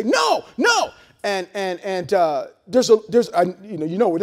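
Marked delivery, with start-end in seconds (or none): 0.0-0.9 put-on panicked mother yelling at her child; retold shout. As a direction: yell no like a mother seeing her child run. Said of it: "I hear her say".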